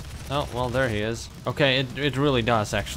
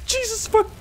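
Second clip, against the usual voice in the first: startled cry; 3 dB louder than usual. Real, not acted. Startled by enemy attack.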